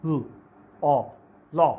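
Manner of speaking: लॉ split into ल, ओ, then joined; syllable-by-syllable demo. Shown as a demonstration. Teacher breaking लॉ into sounds for students. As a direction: build लॉ slowly from its sounds. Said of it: "दांतों के back पे ऊपर लगा के".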